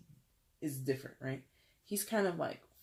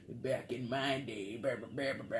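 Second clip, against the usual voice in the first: mumbling grandma; 3 semitones lower, 3 dB quieter than usual. Acted. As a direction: mumble away like a muttering grandma. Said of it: "mumbling to herself".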